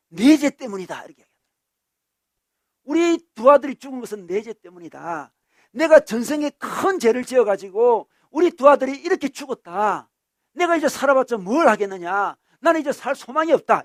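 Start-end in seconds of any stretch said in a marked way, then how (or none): 0.1-0.9 loud, raised-pitch burst of guilt; acted-out father blaming himself; self-blaming father. Bereaved father in an interview, self-blaming outburst. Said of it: "그 아버지가 이렇게 이야기하더라고요". 2.8-13.8 loud, high, anguished retelling; despairing father. Retold or imitated. Father who lost twin sons, despairing lament. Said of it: "이렇게 인터뷰하는 모습을 봤습니다".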